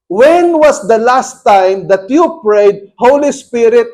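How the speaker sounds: loud, slow, emphatic preaching; forceful preaching. Acted. Preacher exhorting the congregation, loud and slow. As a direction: drive each word home slowly.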